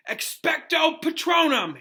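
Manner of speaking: high, slow, loud spell shout; desperate battle cry, pitched high and drawn out; yelled spell. A narrator's reading. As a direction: yell the charm with all his might. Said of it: "Harry yelled".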